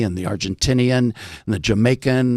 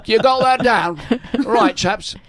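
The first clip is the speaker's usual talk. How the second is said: put-on higher, London schoolmaster's R-less accent; West End teacher voice; 9 semitones higher than usual. French teacher checking on his boys, London accent. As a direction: chivvy the chaps like an old London master. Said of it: "and he would say".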